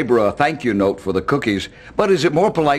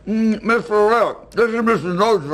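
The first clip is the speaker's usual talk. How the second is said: talking through a mouthful of cookies; muffled, chewing while he speaks; mouth full; 7 semitones higher than usual. Acted. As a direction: mumble it round a big bite. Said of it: "I cannot understand a word you're saying".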